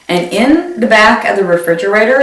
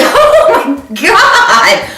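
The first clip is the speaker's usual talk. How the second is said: slow, drawn-out laughing disbelief; laughing oh my God; 12 semitones higher, 3 dB louder, much slower than usual. Retold or imitated. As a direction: laugh it out slowly, stunned.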